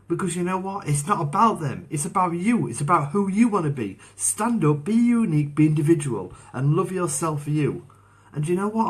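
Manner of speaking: high, loud, rousing exhortation; fervent motivational push, pitched well up; impassioned pep talk. Real, not acted.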